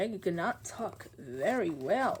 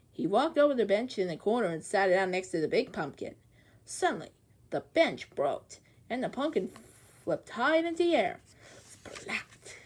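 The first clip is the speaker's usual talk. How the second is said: usual speaking voice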